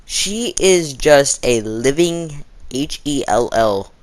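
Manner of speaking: H E L L spelled letter by letter, slow and loud; spelled-out emphasis. Real, not acted. Complaining about her math teacher, spelling H E L L slow. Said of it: "spells out hell slowly for emphasis".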